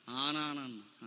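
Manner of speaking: soft, low, trailing off; hushed reply. Retold or imitated. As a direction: say it quietly like a shy girl. Sister's hushed reply on phone. (మెల్లగా, తక్కువ స్వరంలో)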